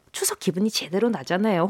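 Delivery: soft unvoiced aside; whispering. Real, not acted. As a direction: whisper it as a secret.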